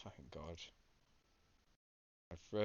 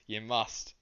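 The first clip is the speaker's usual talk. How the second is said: ordinary delivery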